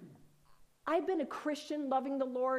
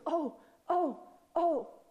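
higher, louder startled gasps of oh; 5 semitones higher, 4 dB louder, much slower than usual. Retold or imitated. Woman hugging Karen suddenly startled, loud surprised oh. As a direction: catch your breath in amazement.

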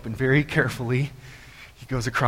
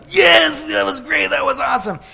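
high excited cheer, loud at first; cheering crowd; 11 semitones higher, 4 dB louder than usual. Acted. Crowd after he reaches the other side, excited cheering. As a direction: burst out high, then ease off. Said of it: "and the crowd is".

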